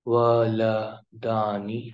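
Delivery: الولدانی drawn out slowly; slow teaching pronunciation; slow model word. Shown as a demonstration. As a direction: stretch الولدانی. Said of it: "دو لڑکوں کے بارے میں".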